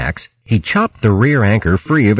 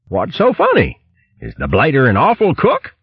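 higher, baffled hare; puzzled posh hare; 8 semitones higher than usual. Acted. Bo the hare puzzled at the crew's joke, higher. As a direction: ask it like a bemused toff.

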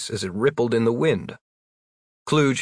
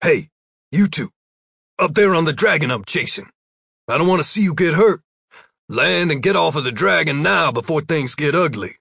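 raised calling voice; 5 semitones higher, 3 dB louder than usual. A narrator's reading. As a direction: call up to them firmly. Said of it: "a voice called out".

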